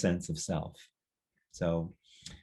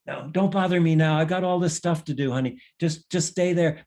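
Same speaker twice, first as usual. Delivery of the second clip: higher, brushing-off busy mother; busy mom; 6 semitones higher, 3 dB louder than usual. Acted. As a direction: wave the child away while unpacking groceries. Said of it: "And mommy says".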